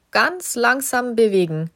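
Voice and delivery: plain reading voice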